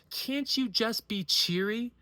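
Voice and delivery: rising outrage at the ex's dismissal; indignant mock quote. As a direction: spit her words back, bitter and slow. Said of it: "And instead frame it as".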